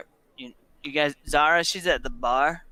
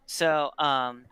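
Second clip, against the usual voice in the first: usual speaking voice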